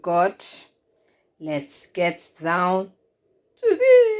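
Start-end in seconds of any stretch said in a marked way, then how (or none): 3.6-4.1 very high, loud, drawn-out squeal; playful squeal. Real, not acted. Cook rallies viewers to get started, high playful squeal. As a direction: squeal it out way up high to kick things off.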